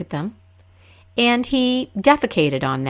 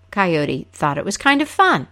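normal talking voice

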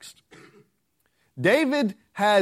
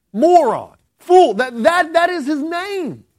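emphatic shout; 7 semitones higher, 5 dB louder than usual. Real, not acted. Preacher hammering the name's meaning.

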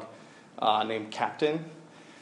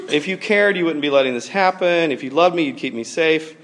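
raised, hurt accusation; accusing disciples; 4 semitones higher, 6 dB louder than usual. Acted. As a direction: throw the blame at him, loudly. Disciples accusing sleeping Jesus.

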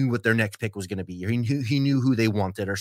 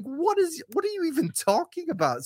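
baffled disbelief. Retold or imitated. Own baffled reaction to rant.

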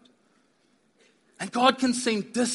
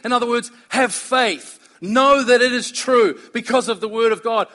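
louder, driving sermon voice; preaching; 5 dB louder than usual. Acted. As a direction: press the point home to the congregation. Preaching at full force.

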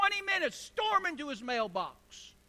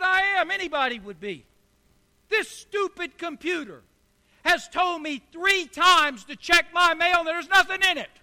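angry man; 4 semitones higher, 8 dB louder than usual. Acted. Angry man's punchline quote.

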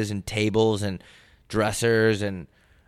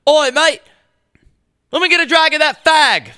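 put-on loud, high British accent; 7 dB louder than usual. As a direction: bellow it like a London lad in a British accent. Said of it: "if you're doing it in a British accent".

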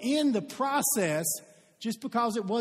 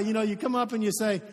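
ordinary delivery

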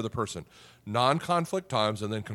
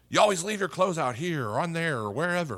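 higher, nagging yell at a spouse; 6 semitones higher than usual. Shown as a demonstration. Pastor demonstrates yelling at a spouse, in an angry yell. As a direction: snap it out like an exasperated partner. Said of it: "That's yelling".

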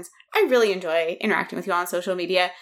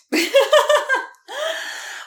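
high laugh; 5 dB louder than usual. Real, not acted. Host laughing at own joke.

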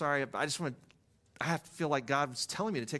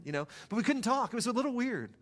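normal talking voice

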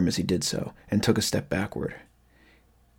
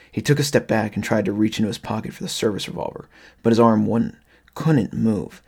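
ordinary delivery

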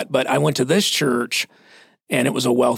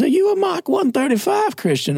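hammed-up Southeastern voice, pitched way up; Southern pastor impression. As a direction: repeat the question in a high-pitched Southern accent. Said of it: "he talked like this".

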